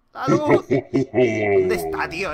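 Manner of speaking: panicked cry. Real, not acted. Player panicking when the light goes out, loud cry.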